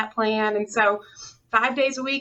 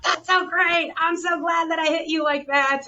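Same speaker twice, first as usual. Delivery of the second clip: pleased instructor; 6 semitones higher than usual. Retold or imitated. Instructor's delighted reply retold.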